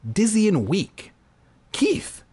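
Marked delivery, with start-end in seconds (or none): 1.7-2.2 high character voice. Acted.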